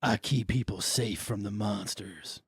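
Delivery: calm menace, low and slow; low quiet drifter. Acted. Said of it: "There you go".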